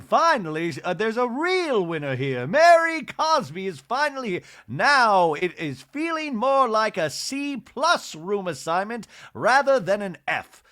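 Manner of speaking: high, loud mock-snob voice, slowing; snooty Bronwyn. Mocking Bronwyn gloating over Mary joining, snooty. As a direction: ham up the smug superiority.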